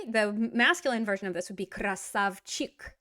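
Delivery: usual speaking voice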